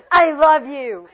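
loud, angry declaration. Shown as a demonstration. Teacher showing the class an angry take, angry. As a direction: fire it out angrily, high and loud. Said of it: "Let me see your anger".

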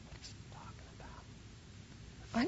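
slow, hushed inner thought; bewildered whisper. Retold or imitated. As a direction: whisper your puzzlement to yourself.

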